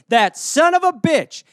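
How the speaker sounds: high, loud, slow indignant curse; mock outrage. Official hearing Saddam terrorizes the weather, mock outrage. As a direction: spit out the insult in fake fury.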